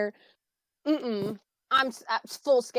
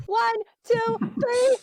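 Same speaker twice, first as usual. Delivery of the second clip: high, loud photo countdown; 11 semitones higher, 4 dB louder, slower than usual. Real, not acted.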